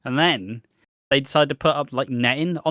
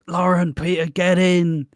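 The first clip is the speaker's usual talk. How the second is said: high, loud call; 6 semitones higher, 6 dB louder than usual. Retold or imitated. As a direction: shout them into the van. Calling friends into the van.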